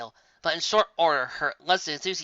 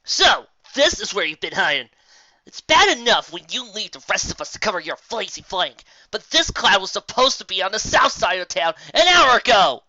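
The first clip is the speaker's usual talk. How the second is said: much louder, higher telling-off; angry scolding; 6 semitones higher, 9 dB louder than usual. Acted. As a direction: chew out a lazy coworker. Scolding lazy Rainbow Dash.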